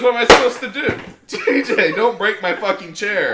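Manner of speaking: high, loud whiny grandma plea; imitating the grandmother. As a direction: play the grandma pleading helplessly. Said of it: "she goes".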